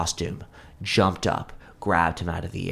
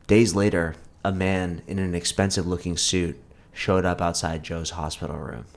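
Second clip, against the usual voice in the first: plain reading voice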